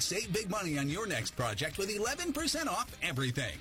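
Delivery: ordinary delivery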